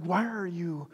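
imitating a wife's hushed plea to calm down; soft, half-whispered attempt to settle someone. Imitated wife calming a panicked husband.

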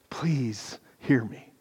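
breathy whisper, slow and close; whispered plea. Real, not acted. Pastor whispers a plea to his congregation, slow and hushed. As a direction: lean in and whisper it to them. Pastor pleads softly with listeners.

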